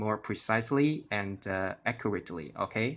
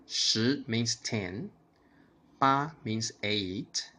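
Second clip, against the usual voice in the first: slow, deliberate shi and ba; walk through it slowly; slow teaching; slower than usual. Shown as a demonstration. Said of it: "imitate my tone and intonation".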